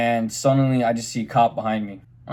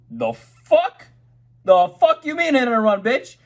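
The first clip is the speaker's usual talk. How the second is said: much higher, louder incredulous outburst; outraged disbelief; 11 semitones higher, 3 dB louder, slower than usual. Retold or imitated. Retelling his reply to the cops, shrill outraged disbelief. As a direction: shoot it back in stunned outrage. Told arrest for hit and run.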